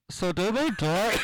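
put-on high, loud, slow kid's voice over a headset; twelve-year-old kid. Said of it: "he was a twelve-year-old".

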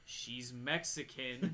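low, very slow restatement; deadpan correction. Retold or imitated. Guard amends description over the radio, deadpan correction.

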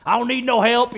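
loud, high proud refusal; mock stubborn man. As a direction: play the proud guy refusing help. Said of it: "you lay in the yard".

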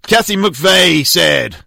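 ordinary delivery

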